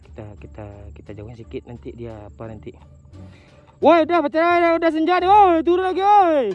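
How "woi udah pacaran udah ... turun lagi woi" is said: very high, full-volume heckling yell; shouting at a couple. Real, not acted. Drone operator heckles a dating couple, shouting. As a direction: holler it at people far away.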